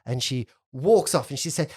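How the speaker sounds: normal talking voice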